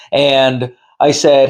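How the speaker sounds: ordinary delivery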